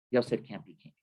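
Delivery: usual speaking voice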